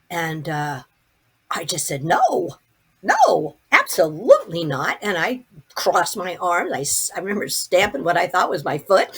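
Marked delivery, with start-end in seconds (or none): 2.0-5.0 emphatic, stubborn no; retold protest, punched hard; indignant refusal. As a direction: dig in and refuse outright. Refuses to be sent back.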